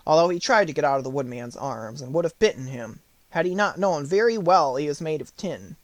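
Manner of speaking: ordinary delivery, nothing marked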